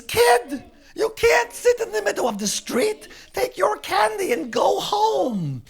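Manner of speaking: high, loud, scolding cop; bossy officer at full volume; shouting policeman. Acted. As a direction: holler an order at a kid, dragging out the end.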